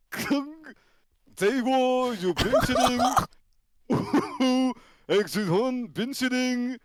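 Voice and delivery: high mock Mandarin, drawn out; hammed-up imitation Chinese; Chinese accent. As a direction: parody a wrestler speaking Chinese. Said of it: "l'accent chinois".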